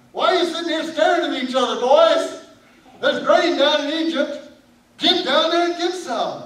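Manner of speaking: loud, high, impatient scolding of grown sons; old Jacob fed up with his staring sons; exasperated father. Acted. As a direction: bark at the boys to get moving.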